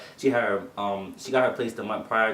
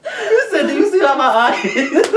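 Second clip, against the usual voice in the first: squealing with laughter, much higher and louder; laughing; 9 dB louder than usual. Real, not acted. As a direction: repeat it cracking up. Friend repeats quote while laughing.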